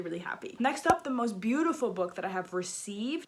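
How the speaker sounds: ordinary delivery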